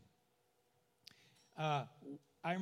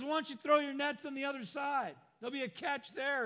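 high voice carrying over distance; calling out; 8 semitones higher than usual. Acted. Jesus calls to fishermen from shore, calling out. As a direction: call advice across the water.

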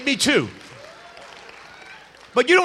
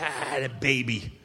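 amused laugh; 10 semitones lower, 7 dB quieter, slower than usual. Retold or imitated. Laughing at brother's confession.

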